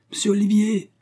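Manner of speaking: high, joyful shout from afar; calling out. A narrator's reading. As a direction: call to him across the garden. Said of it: "cria-t-il de toutes ses forces".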